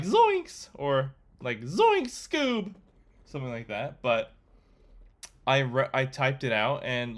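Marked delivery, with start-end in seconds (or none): 0.0-0.7 high, cracking cartoon Shaggy yelp. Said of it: "like, a Shaggy reference". 1.6-2.7 high, cracking cartoon Shaggy yelp. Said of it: "like, a Shaggy reference".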